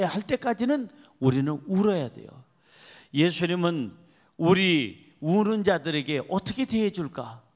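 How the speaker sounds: usual speaking voice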